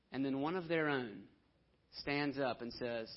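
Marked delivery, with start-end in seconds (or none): none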